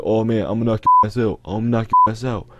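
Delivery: mildly acted mad kid making threats; mock a riled-up boy, only lightly heated; imitated angry threat. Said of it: "And he got real mad".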